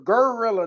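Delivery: "gorilla" with a heavy r-coloured "gurr" start; Memphis gorilla. Retold or imitated. Showing how Memphis rappers say it, country "gurr-illa" for "gorilla". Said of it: "Fucking talk like gor".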